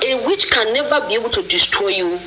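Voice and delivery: usual speaking voice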